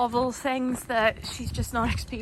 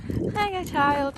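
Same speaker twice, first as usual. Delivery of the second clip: much higher, breezy greeting for the calm dog; 7 semitones higher than usual. Retold or imitated. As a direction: greet the child like it's nothing special. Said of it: "And Phoebe's like".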